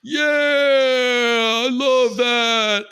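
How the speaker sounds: high, loud, drawn-out bellow; roaring orc judge. Acted. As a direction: holler it slowly like a delighted orc. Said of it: "the orc judge is like".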